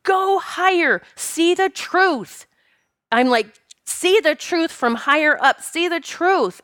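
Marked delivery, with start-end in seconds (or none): 0.0-2.5 high, urgent mock yell, drawn out slowly; retold yelling. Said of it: "and I'm yelling". 3.8-6.6 high, insistent mock yell; retold yelling. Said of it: "and I'm yelling".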